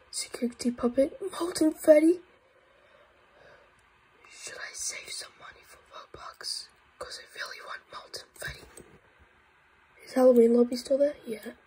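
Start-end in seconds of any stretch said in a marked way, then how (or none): none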